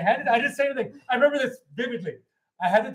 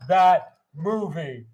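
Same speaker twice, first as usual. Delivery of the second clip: loud, very slow declaration; emphatic adoration; 6 dB louder, much slower than usual. Real, not acted. Storyteller giving his verdict on the movie, slowly and emphatically. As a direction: punch each word separately.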